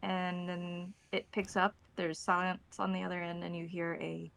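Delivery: usual speaking voice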